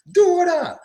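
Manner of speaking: high mock yell Дура; imitating Russian driver. Teacher repeats the video driver's insult as a mock yell. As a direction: toss off the clip's Дура way up high. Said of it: "Somebody's dying laughing".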